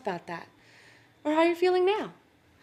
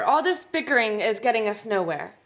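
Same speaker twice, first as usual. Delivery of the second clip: normal talking voice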